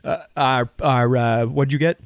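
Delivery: lightly mocked Boston local on camera; hammed-up Boston accent. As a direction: play a Boston man-on-the-street interviewee. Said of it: "in front of a news camera where you're like".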